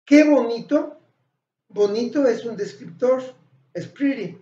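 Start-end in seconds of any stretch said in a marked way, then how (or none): 0.0-1.0 higher, louder delighted exclaim; teacher demos an exclamation, louder and higher. Shown as a demonstration. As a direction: show admiration, say it like a compliment. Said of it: "Si yo digo".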